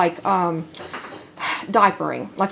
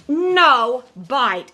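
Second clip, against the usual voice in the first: firm demo command; 3 semitones higher than usual. Shown as a demonstration. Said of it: "this is how it's done".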